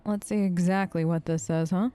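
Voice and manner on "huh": ordinary talk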